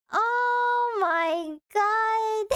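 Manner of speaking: drawn-out amazed shout; slow, astonished oh my god; stunned shout. Real, not acted. Reacting to donation amount.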